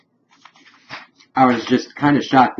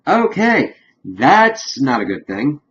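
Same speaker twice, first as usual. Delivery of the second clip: startled okay; 6 semitones higher, 6 dB louder, slower than usual. Retold or imitated. Group reacting with alarm.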